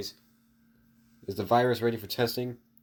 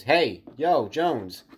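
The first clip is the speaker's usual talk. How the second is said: loud call from behind, a bit higher; 4 semitones higher, 8 dB louder, much slower than usual. Acted. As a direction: call his name across the hall. Someone calls Deku from behind.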